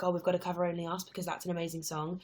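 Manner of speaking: normal talking voice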